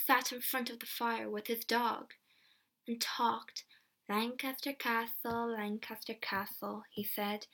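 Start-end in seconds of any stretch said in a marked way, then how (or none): 4.1-6.8 soft, sinking repetition; repetitive muttering. A narrator's reading. James sits by the fire, repeating softly. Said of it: "again and again".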